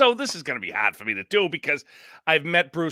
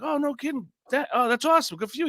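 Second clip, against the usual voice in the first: much higher, bright mock enthusiasm; retold excitement; 10 semitones higher, faster than usual. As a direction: gush like you're thrilled for him. Retells his excited reply to Bruce.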